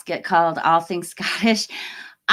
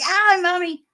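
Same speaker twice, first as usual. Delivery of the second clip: granddad's yelp, high and louder; mock protest; 11 semitones higher, 6 dB louder than usual. Granddad protesting to his mother after the teapot, high mock yelp. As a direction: act out a startled grown son's high complaint.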